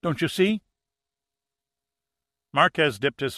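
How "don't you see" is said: high, urgent plea; high frightened urging. A narrator's reading. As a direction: beg them to look. Frightened woman pleading.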